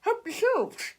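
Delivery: high bright offer; cheery host. A narrator's reading. Mister Snoddy offering tissues, cheery. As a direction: sing out the freebie. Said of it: "said Mister Snoddy".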